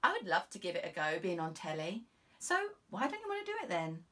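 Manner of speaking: gushing about being on telly; a young officer puzzled by her boss; eager young constable. Acted.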